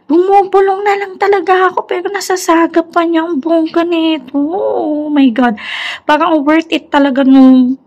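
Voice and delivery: high-pitched, gushing amazement; giddy excitement. Real, not acted. Vlogger satisfied that the upgrade was worth it, gushing. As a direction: marvel at it, pitch soaring. Delighted by the speaker test.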